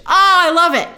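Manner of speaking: loud, high cry of delight; excited. Real, not acted. Fan delighted by publisher name trivia, excited. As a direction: gush with real fan joy.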